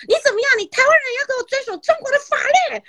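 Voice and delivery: high, fast yelling policeman; a policeman yelling at the Taiwanese driver, imitated high and fast; mock furious cop. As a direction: scold her like a furious officer. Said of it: "指着我大骂说".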